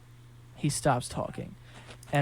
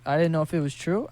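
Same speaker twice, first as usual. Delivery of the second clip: usual speaking voice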